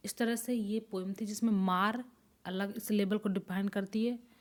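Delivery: ordinary talk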